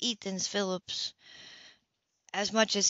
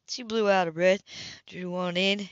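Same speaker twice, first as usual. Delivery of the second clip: usual speaking voice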